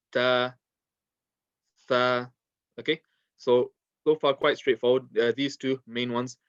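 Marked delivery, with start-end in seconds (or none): 0.1-0.5 "ta" with tongue tip between gums and teeth; letter ta demonstration. Shown as a demonstration. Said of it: "tip of the tongue between the gums and the teeth". 1.9-2.3 "tha" with tongue tip on the top two teeth; letter tha demonstration. Shown as a demonstration. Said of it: "tongue touching the tip of the top two teeth".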